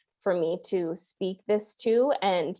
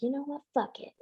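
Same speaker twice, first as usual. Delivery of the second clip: muttered resignation; 5 semitones higher, 9 dB quieter, faster than usual. Retold or imitated. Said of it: "I was just like".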